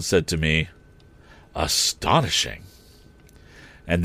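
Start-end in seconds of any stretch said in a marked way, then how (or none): 1.5-2.6 slow, low, stunned "Astonishing"; dazed Joe. A narrator's reading. Joe against the wall, dazed and slow. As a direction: let it out in a daze.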